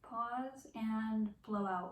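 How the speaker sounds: drawn out, calm and slow; slow breathing cue. Shown as a demonstration. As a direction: ease each word out on the breath. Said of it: "So here we go".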